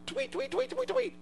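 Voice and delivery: high chirping tweet; bird tweet. Pretend. Preacher imitates the dove.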